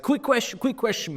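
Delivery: mocking high-pitched eager fan; high, nagging mock fan voice; pushy fan. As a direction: squeak it out like a pestering fan. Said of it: "And then the guy's like".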